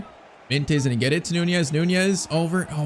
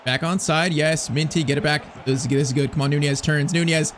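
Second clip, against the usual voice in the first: normal talking voice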